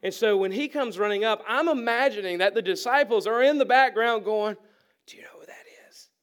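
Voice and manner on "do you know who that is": hushed, hurried whisper; excited whisper. Acted. Disciples in the background as the rich man runs up, whispering. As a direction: whisper it behind someone's back.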